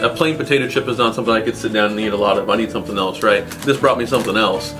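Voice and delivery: ordinary talk, nothing marked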